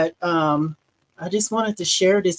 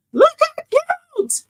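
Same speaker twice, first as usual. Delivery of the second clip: much higher and louder, gushing with delight; excited squeal; 6 dB louder than usual. Real, not acted. To her viewers, showing off the finished piece, in a high excited squeal. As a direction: squeal over how cute it turned out.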